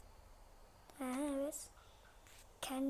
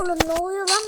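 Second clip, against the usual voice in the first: loud confused shout; 3 semitones higher, 11 dB louder, faster than usual. Real, not acted. Confused by game chaos.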